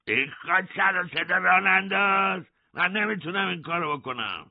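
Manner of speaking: guard shouts. A narrator's reading. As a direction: bark back at the prisoner. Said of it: "داد زد". (فریاد نگهبان با صدای بالاتر)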